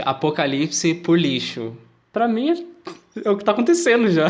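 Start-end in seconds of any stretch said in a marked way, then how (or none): 2.1-4.3 words breaking into a laugh, high; giggling through the sentence; laughing while talking. Real, not acted. Laughing at grim garbage apocalypse.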